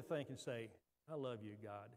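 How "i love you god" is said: soft, low prayer; quiet, private declaration to God; hushed near-whisper. Shown as a demonstration. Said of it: "I want you to whisper it".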